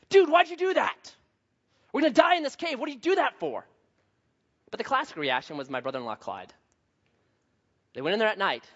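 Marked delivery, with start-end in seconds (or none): 0.1-3.7 much higher, louder, imitated angry outburst; mad friend. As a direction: scold him for breaking the light. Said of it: "He got mad".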